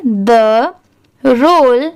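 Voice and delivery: word by word with pauses; careful teacher's dictation pace; repeat-after-me model. Shown as a demonstration. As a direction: say each word separately for learners to echo. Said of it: "आप मेरे बाद ज़रूर बोलिए".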